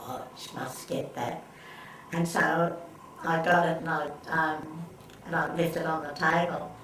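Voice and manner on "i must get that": soft, slow, breathy whisper to herself; whispered thought. Retold or imitated. Shopper thinking to herself, whispered and slow. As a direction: whisper it like a secret wish.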